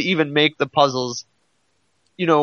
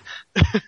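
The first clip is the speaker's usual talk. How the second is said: a short real burst of laughter; laughing; 5 semitones higher than usual. Real, not acted. Chatting friends about games, laughing at time travel jokes. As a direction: laugh at the silly game logic. Laughs at the absurd game premise.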